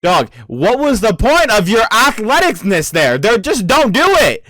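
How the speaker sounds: high, climbing exasperation; exasperated scolding. Real, not acted. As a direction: scold the character in disbelief, pitch climbing. Exasperated at character's failed dodge.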